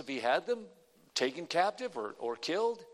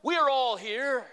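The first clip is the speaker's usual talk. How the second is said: higher, louder, slow call; loud cry; 7 semitones higher, 6 dB louder than usual. Acted. Paul calling to the jailer, loud cry. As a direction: shout We are all here to the jailer.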